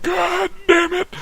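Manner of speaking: very high, slow, drawn-out yelled curse; dad's furious shout. Retold or imitated. Dad exploding at the boat shed news, slow furious shout. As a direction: bellow it out, drawing each word.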